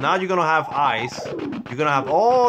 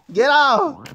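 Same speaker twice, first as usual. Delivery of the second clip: laughing panic; slower than usual. Real, not acted. Player overwhelmed by puppies, shrieking with laughter. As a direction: shriek at them while laughing.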